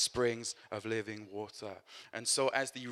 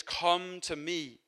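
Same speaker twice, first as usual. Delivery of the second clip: crying out; 6 semitones higher, much slower than usual. Retold or imitated. Preacher voicing Jesus calling to Israel, slow raised call. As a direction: call it out slowly like an invitation. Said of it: "He stands and cries out".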